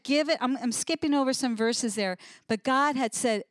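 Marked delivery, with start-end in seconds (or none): none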